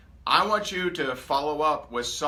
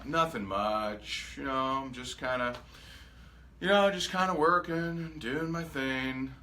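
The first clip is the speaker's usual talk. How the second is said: unenthused reply. Retold or imitated. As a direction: sound like someone with nothing going on. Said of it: "you're not fired up or inspiring".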